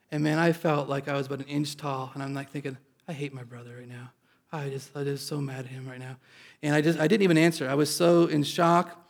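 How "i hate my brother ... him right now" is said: muttered angry thought, much softer; quiet inner grumble. Retold or imitated. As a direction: grumble it to yourself under your breath.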